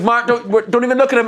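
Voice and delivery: high, agitated retold mum; mock urgent warning. Mum warns son while driving past, urgent warning.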